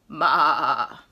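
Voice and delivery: low, loud bleat; sheep bleat. Retold or imitated. Reviewing the sounds, low loud bleat. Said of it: "let's see if I got the sounds right".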